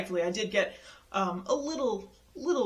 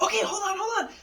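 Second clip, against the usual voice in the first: urgent hurried; 13 semitones higher, 4 dB louder than usual. Retold or imitated. Agent rushes to stop boarding.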